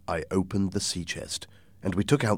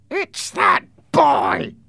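harsh, slow, rasping shout; strained villain's yell; Blind Pew; 7 dB louder, much slower than usual. Acted. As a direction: roar the accusation, dragging each word. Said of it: "Blind Pew shouted".

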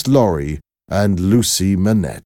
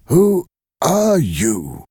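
high, slow, wondering question; bewildered old man; 10 semitones higher, much slower than usual. Acted. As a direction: ask slowly as if seeing a ghost. The old man stares at Lucy.

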